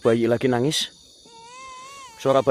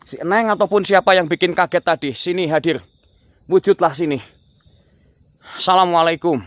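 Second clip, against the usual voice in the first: high, raised call to a spirit; commanding summons; 6 semitones higher than usual. Real, not acted. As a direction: hail and order it to appear. Calls out to the spirit. (memanggil lantang)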